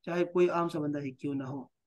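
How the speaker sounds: normal talking voice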